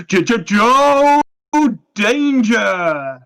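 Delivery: high, slow, stretched announcer cry; cartoonish video game voice-over shout; Joe Danger game intro. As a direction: belt it like an old game's title call. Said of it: "do the following sound effect".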